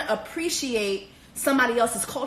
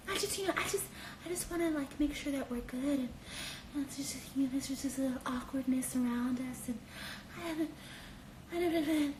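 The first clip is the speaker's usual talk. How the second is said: seductive hush; 12 dB quieter than usual. Retold or imitated. Jessica alone with Barnett by the fire, much softer voice. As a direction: hush it close and intimate.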